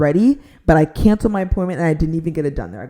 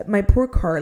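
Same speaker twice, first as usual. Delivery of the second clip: ordinary talk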